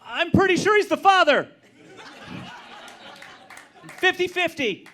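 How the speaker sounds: high, loud mock wife voice; wife's voice. Wife calling from the car, high mocking voice.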